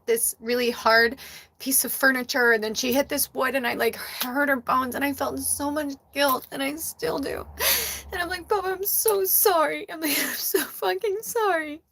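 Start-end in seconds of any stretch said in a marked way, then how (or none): 4.9-11.8 soft, tearful guilt; real crying. Real, not acted. Owner confessing guilt over hurting her cat, crying softly. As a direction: voice breaking into a tearful apology. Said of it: "oh, I'm starting to cry".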